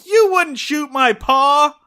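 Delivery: put-on high, loud, slow kid's shout; Ronny Howard. Boy suddenly realizing the danger, loud childlike cry. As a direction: blurt it out with no volume control. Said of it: "inability to say anything at a normal volume".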